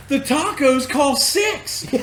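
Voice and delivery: high, drawn-out shout; mock protest. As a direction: imitate someone hollering about the price. Acted quote, arguing over price.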